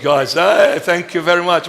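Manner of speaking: ordinary delivery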